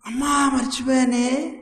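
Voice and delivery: put-on a boy running in, a touch childish; child calling mom. Child rushes in calling mother. (అమ్మను పిలిచే పిల్లవాడి గొంతు)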